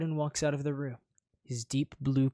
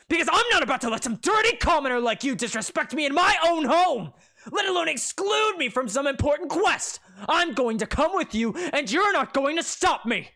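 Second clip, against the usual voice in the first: heated declaration; 13 semitones higher, 8 dB louder than usual. Acted.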